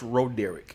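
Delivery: "Roderick" as roh-DERR-ick; Ro-Derrick pronunciation. Shown as a demonstration. Explaining his name to the host, "Roderick" as roh-DERR-ick. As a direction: say "Roderick" with long O, then Derrick. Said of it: "pretty much Roderick".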